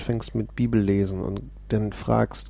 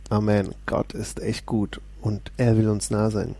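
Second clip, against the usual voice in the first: usual speaking voice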